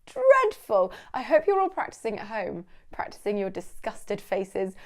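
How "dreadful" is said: "Dreadful" flung out much higher, louder; hammed-up disgust. Shown as a demonstration. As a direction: recoil in theatrical horror at "Dreadful". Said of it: "show absolute disgust".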